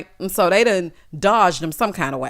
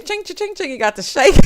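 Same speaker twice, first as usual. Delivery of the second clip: ordinary talk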